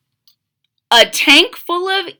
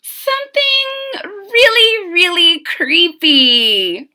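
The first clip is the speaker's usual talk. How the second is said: put-on much higher girl voice stretching the second really; little girl, eager; 7 semitones higher than usual. Mitzi asks the shop lady for something creepy: high, eager girl voice. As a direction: savour the creepiness like a treat. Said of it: "she said".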